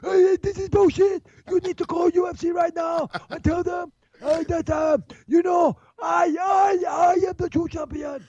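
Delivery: high-pitched mock shouting, far above his own voice; mock frantic call to manager. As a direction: squeal it out like a panicked fighter ringing his manager. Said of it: "he called his manager straight".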